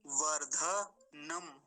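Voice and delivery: वर्धनम very slowly with a full aspirated ध; slow model with a clear ध; correct full dha. Shown as a demonstration. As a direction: stretch वर्धनम, lean on the ध. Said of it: "ऐसा धकार का ध्यान रखेंगे".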